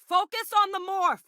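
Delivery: high, urgent holler; high-pitched shout to a teammate; shouted combat order. Acted. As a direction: yell the order over a firefight.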